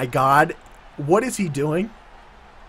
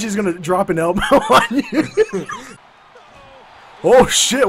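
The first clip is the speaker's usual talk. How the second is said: ordinary talk, nothing marked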